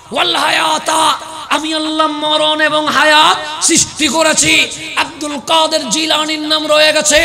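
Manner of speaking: loud, rising sermon cry over music; fervent waz preacher voice; preaching at full force. Acted. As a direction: build the crowd up, calling out each line. Long climactic sermon run.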